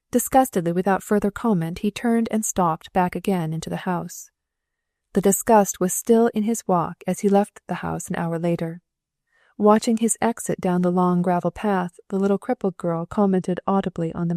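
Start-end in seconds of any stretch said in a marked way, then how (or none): none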